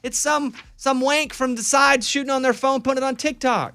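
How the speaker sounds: heated rant. Real, not acted. Ranting about viewers.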